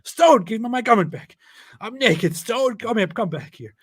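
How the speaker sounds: high, loud hammed-up plea; mock frantic shouting. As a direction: call desperately after a runaway thief. Acts Moses chasing the stone.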